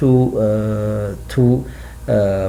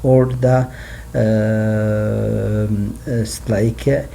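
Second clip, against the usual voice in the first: ordinary talk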